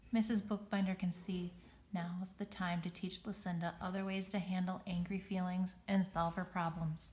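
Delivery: ordinary delivery, nothing marked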